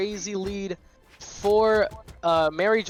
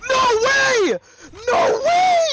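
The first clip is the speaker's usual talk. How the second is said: high, loud disbelieving shout; 8 dB louder, much slower than usual. Real, not acted. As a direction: scream it in disbelief. Caster shouting at a comeback.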